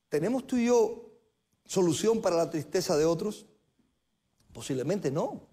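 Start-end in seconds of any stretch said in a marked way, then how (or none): none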